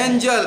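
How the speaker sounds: एंजल with a "j" in the middle; soft g. Shown as a demonstration. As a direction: model एंजल, g softened to j. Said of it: "क्या पढ़ना है".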